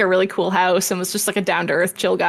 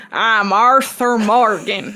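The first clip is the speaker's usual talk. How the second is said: slow Southern drawl, lightly done; Southern Arthur Morgan voice; 2 semitones higher, 4 dB louder, much slower than usual. Retold or imitated. Lawyer imitating the actor with a slow Southern drawl. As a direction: drawl the name out in a Southern outlaw voice. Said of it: "he could still do a Southern voice".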